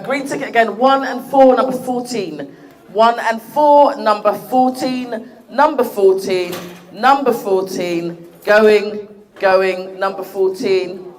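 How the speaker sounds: slow drawn-out number calling; bingo caller. Pretend. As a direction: stretch each number out like a bingo hall caller. Bingo-style number calling, going going.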